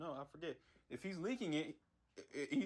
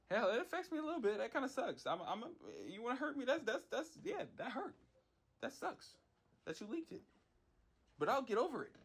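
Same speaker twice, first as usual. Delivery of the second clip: higher, whiny mock-wounded voice; mock hurt; 7 semitones higher than usual. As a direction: play-act being hurt, hammed up and higher. Play-acts being hurt by leak.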